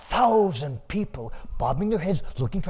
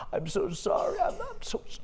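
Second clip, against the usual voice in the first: weeping apology; 5 dB quieter than usual. Acted. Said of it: "as he wept with great sorrow".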